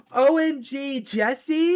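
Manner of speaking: high, loud, gushing exclamation; hammed-up mom spotting someone she knows; excited mom greeting. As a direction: squeal it like a thrilled mom.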